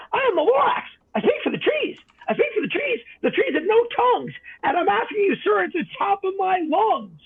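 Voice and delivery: a tiny guardian scolding at the top of his lungs; sharpish bossy Lorax. Acted. The Lorax speaking for the trees, high and bossy. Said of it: "he spoke with a voice that was sharpish and bossy".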